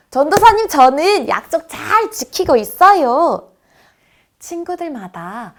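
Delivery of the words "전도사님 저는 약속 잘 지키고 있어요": put-on high, loud child boasting to teacher; proud child. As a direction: call out like an eager pupil. (자랑스럽게 큰 소리로 외치는 아이 목소리)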